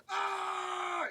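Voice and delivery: short high yell, held back in volume; contained yell. Shown as a demonstration. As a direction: yell but keep it contained. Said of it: "if I yell, it's, I'm like".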